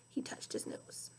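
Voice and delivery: hushed unvoiced narration; whisper. A narrator's reading. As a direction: whisper it ominously. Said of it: "whispered narration".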